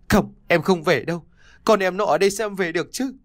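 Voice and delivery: high, fast, pleading protest; cling to the river, refuse through tears; tearful refusal. A narrator's reading. Wife refusing her husband by the river, tearful and pleading.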